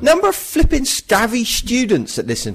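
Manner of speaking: loud hammed-up rant, pitched high; mock rant. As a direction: rant at the students for a joke. Mock rant at student listeners.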